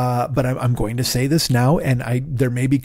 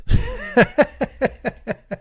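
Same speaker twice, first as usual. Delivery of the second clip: big high laugh; 12 semitones higher than usual. Real, not acted. Laughing at own self-blame.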